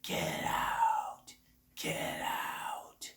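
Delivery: drawn-out, voiceless haunted-house whisper; Amityville Horror demon. Retold or imitated. Demon warning people to flee the house, eerie whisper. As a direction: rasp out a long, eerie warning without voice. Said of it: "Like the Amityville Horror".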